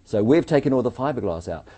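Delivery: ordinary delivery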